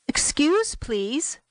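lightly voiced polite stranger, high on the first word; a hint of a halting stranger seeking help. Suitcase man approaches a traveler for help, polite stranger.

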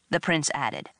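plain reading voice

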